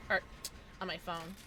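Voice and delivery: ordinary talk, nothing marked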